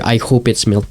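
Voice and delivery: ordinary talk, nothing marked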